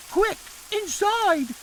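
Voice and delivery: mother shouting in a thunderstorm; urgent shout. Acted. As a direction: call everyone in from the rain. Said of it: "shouted Missus Thomas".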